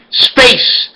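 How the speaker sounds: high, emphatic word. Shown as a demonstration. Reading out a spelling word to viewers, high and emphatic. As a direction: announce the word. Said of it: "Number forty-four".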